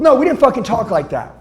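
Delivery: ordinary talk, nothing marked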